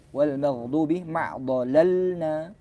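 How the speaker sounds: "wal maghdubi ma dhalalna" read slowly with a heavy dh; slow recitation. Shown as a demonstration. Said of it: "bukan magh". (bacaan lambat dengan dha tebal)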